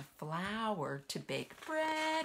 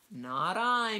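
meowing cat; 5 semitones higher, 5 dB louder than usual. A narrator's reading. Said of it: "meowed the cat".

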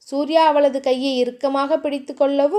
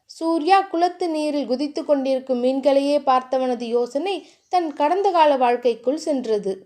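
ordinary delivery

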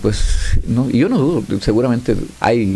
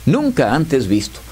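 booming higher movie-trailer intro; old sci-fi announcer; 6 semitones higher than usual. Acted. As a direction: announce it like an epic space saga. Host opens grocery ad dramatically.

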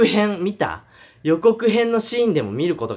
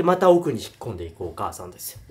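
normal talking voice

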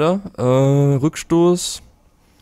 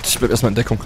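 ordinary talk

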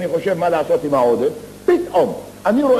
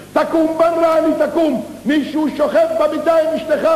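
shouting again; 14 semitones higher than usual. Acted. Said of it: "צועק עוד הפעם נביל מבחוץ".

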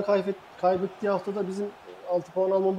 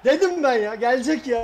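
excited; 5 semitones higher, 5 dB louder than usual. Real, not acted. Co-host after goal.

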